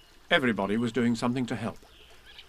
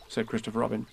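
ordinary delivery

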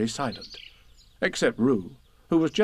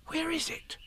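high hushed whisper; 9 dB quieter than usual. Acted. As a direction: whisper it, searching. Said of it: "and whispered".

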